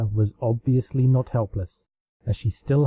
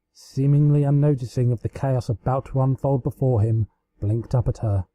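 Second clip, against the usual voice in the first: ordinary delivery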